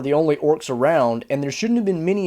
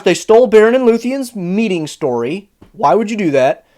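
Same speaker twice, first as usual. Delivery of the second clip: usual speaking voice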